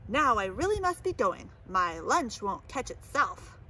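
plain reading voice

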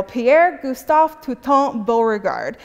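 hammed-up French accent on the general's name; mock-French the name, badly on purpose; the full French name in a bad French accent; horrible French accent. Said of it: "you have to say that in a horrible French accent".

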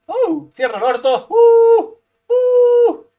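high loud yelp; startled shout; tense gasp. Real, not acted. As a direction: yelp with nerves. Tense moment in fight.

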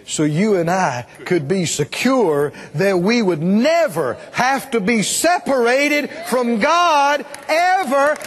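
building to high-pitched, drawn-out preaching; impassioned preaching. Real, not acted. Preacher assuring congregation they will never be separated, impassioned preaching. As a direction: preach it with mounting force, stretching key words.

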